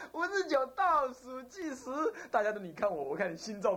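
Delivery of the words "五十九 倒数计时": high, stretched-out count; suspenseful countdown. Retold or imitated. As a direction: hold the tension high on the last count. (高声拖长的紧张倒数)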